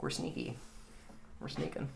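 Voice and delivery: very quiet, stealthy murmur; barely-there sneaking voice; sneaky hushed voice. Retold or imitated. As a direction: play soldiers creeping along, keep it hushed. Soldiers sneaking through no man's land.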